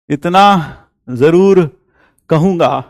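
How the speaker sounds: इतना जरूर stretched out very slowly, each word weighed; slow emphasis. Shown as a demonstration. Teacher repeats his point to students, slowed right down.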